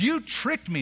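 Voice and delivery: high-pitched protest; retold cry of a cheated bridegroom; mock indignant accusation. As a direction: accuse him in acted outrage. Jacob confronts Laban over trick.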